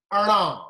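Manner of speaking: Ara with a nasal retroflex sound. Shown as a demonstration. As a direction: air through the nose on Ara. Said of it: "the air comes out of the nose".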